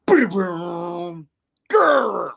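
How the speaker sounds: drawn-out boom and high growl; imitated fight noises. Narrator acting out the brawl, imitated boom and growl. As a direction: make comic-book brawl sound effects.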